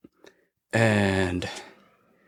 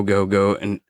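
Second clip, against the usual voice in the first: normal talking voice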